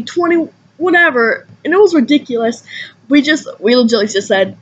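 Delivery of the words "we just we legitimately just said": ordinary delivery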